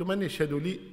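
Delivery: ordinary delivery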